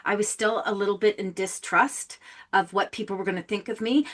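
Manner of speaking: ordinary talk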